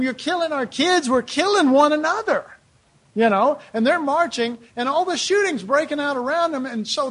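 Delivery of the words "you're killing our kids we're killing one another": higher, mildly impassioned plea; a touch of retold outrage; imitated protest. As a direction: voice a fed-up marcher's protest. Voices protesting peace marchers.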